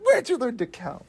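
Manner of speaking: high-pitched movie tough-guy challenge; mob banker impression. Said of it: "and he's like".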